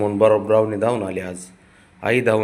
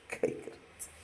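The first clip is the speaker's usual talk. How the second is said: whispered aside; 17 dB quieter, slower than usual. Real, not acted.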